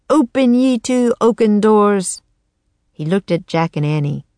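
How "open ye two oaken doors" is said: high, loud grand incantation; sorcerer's shouted spell. A narrator's reading. Teddy commanding the oaken doors, a shouted spell. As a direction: boom out the spell like a boy sorcerer. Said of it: "stretched out his arms and shouted".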